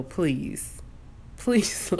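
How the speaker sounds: hiccuping between words; with hiccups. Real, not acted. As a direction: talk through hiccups. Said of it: "I got the hiccups".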